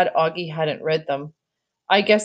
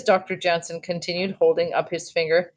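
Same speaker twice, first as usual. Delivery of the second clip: usual speaking voice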